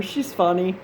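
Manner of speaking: normal talking voice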